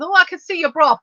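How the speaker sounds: mocking teenage boy. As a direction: taunt her like a cheeky teenage boy. Mimicking teasing schoolboys.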